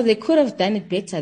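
ordinary talk

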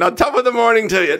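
loud hammed-up Irish greeting; Irish accent. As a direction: shout it in a mock Irish lilt. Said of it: "He went".

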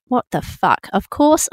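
ordinary delivery

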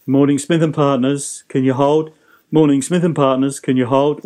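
brisk, rote receptionist greeting; unwilling to help. Shown as a demonstration. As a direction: rattle it off with no interest in helping. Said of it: "you don't sound like you're willing to help".

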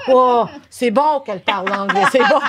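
high, excited mock boss; delighted realization. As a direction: act out a sudden happy discovery. Acts out boss's delighted realization.

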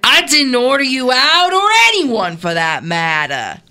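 put-on Southern accent. Co-host slipping accents mid-scene, Southern accent. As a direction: stretch the vowels into a Southern accent. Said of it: "Now I'm Southern".

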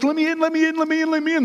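high, urgent rapid pleading; frantic, out of breath. Retold or imitated. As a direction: imitate a breathless woman begging at the door. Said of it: "she's out of breath, and she's out of sorts".

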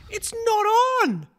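high-pitched mock objection; mimed protest. Golfer throwing arms out at group behind, high mock protest. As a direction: stretch it high, as if calling across a fairway. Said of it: "throwing your arms out going".

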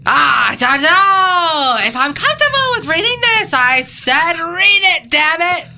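high, loud, wailing protest; hammed-up distress. Reluctant decoy protesting the script, melodramatic wail. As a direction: overact a tearful panic. Acted panic in movie scene.

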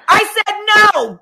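high, loud, slow mock shout; hammed-up God putting his foot down; playful shouted final word; mock booming no. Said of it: "eventually he's like".

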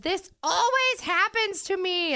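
put-on high, slow, sing-song drunk line. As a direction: say it like a tipsy embarrassed rider. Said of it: "I think I said".

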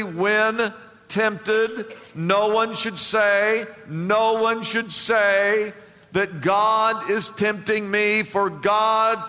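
slow, weighty quote from James; emphatic scripture reading. Shown as a demonstration. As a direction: read it slowly, every word weighed. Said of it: "he says this very emphatically".

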